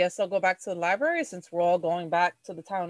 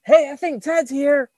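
raised, higher-pitched call; shout; 7 semitones higher, 5 dB louder than usual. Acted. Player calling to the sleeping woman, shouting. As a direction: call it out loudly across the room. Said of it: "I just kinda shout".

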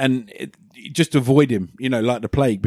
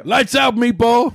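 mock tough taunt, higher; tough guy voice; 10 semitones higher, 3 dB louder, slower than usual. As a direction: sneer it like a boxer. Quoting Clubber line, high.

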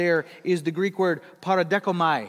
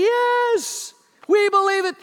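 much higher and louder, an eager crowd; crowd shouting yes; 14 semitones higher, 9 dB louder, slower than usual. Retold or imitated. Crowd answering Blondin, cheering shout. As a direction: shout it back like a cheering mob.

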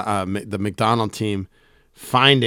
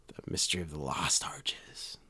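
whispered dramatic title; hushed title; 6 semitones lower, 13 dB quieter than usual. Pretend. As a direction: breathe the title like a secret.

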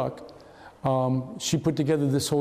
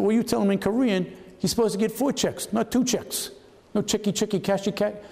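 a higher, mocking broken-English imitation; mock pidgin talk; 6 semitones higher than usual. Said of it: "This is how they talk".

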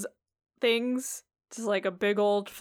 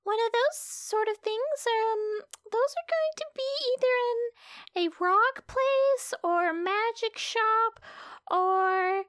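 high NPC voice; 13 semitones higher than usual. Acted.

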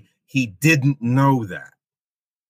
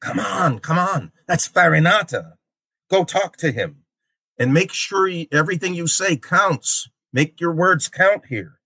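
higher-pitched, insistent urging; urgent coaxing; 5 semitones higher than usual. Acted. Virgil pushes Dante toward a noble shade, urgent coaxing. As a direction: push a reluctant companion forward. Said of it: "And Virgil pushes him".

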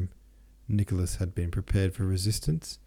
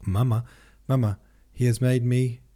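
louder, higher breaking news; excited; 4 semitones higher, 5 dB louder than usual. A narrator's reading. Natasha runs to tell her mother, excited. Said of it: "quite excited".

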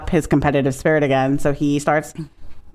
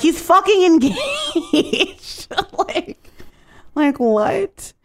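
much higher and very slow, laughing disbelief; incredulous; 13 semitones higher, much slower than usual. Real, not acted. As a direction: stretch it out in squeaky disbelief. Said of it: "disbelieving outburst".

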